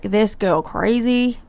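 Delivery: slow, lightly put-on American accent; imitating a neighbour in a hammed-up American accent; a hint of an American drawl, slowed down; mock American accent. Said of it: "why she's got an American accent".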